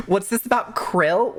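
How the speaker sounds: ordinary talk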